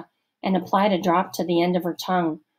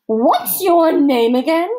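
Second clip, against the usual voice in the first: slow, loud, higher stern matron; stern camp counselor; 9 semitones higher, 8 dB louder, slower than usual. A narrator's reading. Missus Periwinkle interrogating Allison, stern, slow and loud. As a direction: lecture slowly like a suspicious matron.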